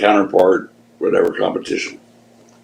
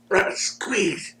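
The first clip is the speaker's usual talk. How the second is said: slow, much higher strained plea; high pleading wrestler; 8 semitones higher, 8 dB quieter, much slower than usual. Retold or imitated. Wrestler urging his partner in the ring, slow high strained. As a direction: stretch it out high like straining in a hold. Jimmy voiced mid-match again.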